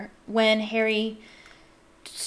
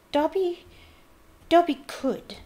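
higher, hesitant elf voice; doubtful; 9 semitones higher, 4 dB louder than usual. A narrator's reading. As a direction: falter as if unsure he dares. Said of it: "he said doubtfully".

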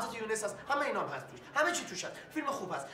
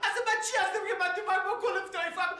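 high, fast, half-laughing outcry; frantic protest; 7 semitones higher, faster than usual. Acted. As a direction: blurt it out in disbelief at the fine. (با خنده و اعتراض تند و بلند)

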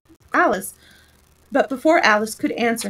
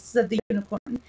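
usual speaking voice

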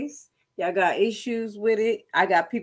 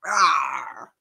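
low playful monster rawr; mock demon growl; 7 semitones lower than usual. Imitating demon crawling out.

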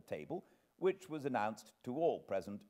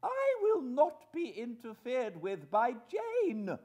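high, slow, loud imperious voice; majestic indignation; 8 dB louder, slower than usual. Acted. Wife refusing Jane's interference, majestically indignant. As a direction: pronounce it slowly with lofty outrage. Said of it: "with a majestic glance".

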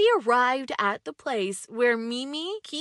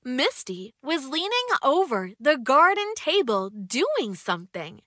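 usual speaking voice

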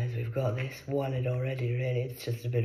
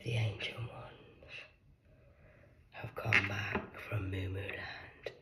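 slow, soft mysterious whisper; ominous announcement; 6 semitones lower, 10 dB quieter, slower than usual. Acted. Announcing the ancient ones' return, slow mysterious whisper. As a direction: intone it like a prophecy.

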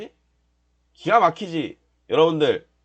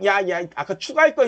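ordinary delivery

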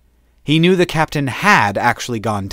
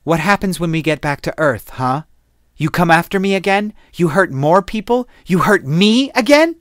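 rising anger; 6 semitones higher than usual. Acted. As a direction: build from a cold question into a hurt accusation. Shu confronts Zane angrily.

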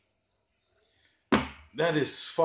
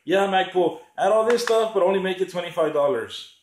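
high, loud mocking demand; hammed-up entitled buyer voice; mock cheap buyer; 5 semitones higher, 5 dB louder than usual. Mocking the cheap buyers' demand to Magpul, high and loud. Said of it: "They're like".

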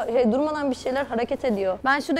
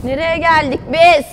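cave echo; 3 semitones higher, 6 dB louder than usual. Real, not acted. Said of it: "sesim yankı yapıyor".

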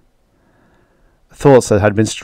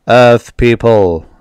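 loud, slow booming proclamation; commanding alien roar; 6 dB louder, slower than usual. A narrator's reading. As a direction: roar it out slowly like a ship's announcement. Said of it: "the voice roared from the spaceship".

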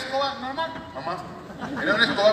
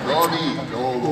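a lightly voiced wolf, lower and slow; a hint of a wolf, lower and dragged out; como lobo; 9 semitones lower, slower than usual. Shown as a demonstration. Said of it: "Que sea como lobo". (lobo grave, lento y algo juguetón)

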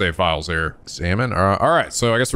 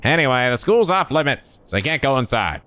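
enthusiastic guard. Acted. Plays the guard giving orders.

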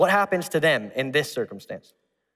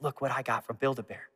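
secretive; 3 semitones lower, 9 dB quieter than usual. Retold or imitated. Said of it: "I'm like".

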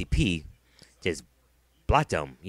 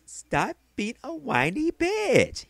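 high, slow, sneering whine; mocking whiny voice; much slower than usual. Mocking the YouTuber he's ranting at, in a high, slow whine. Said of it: "he says".

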